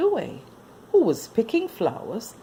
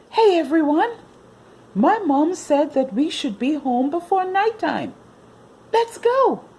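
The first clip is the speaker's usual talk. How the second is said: put-on boy calling friends; 11 semitones higher, 5 dB louder than usual. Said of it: "said Billy to his friends".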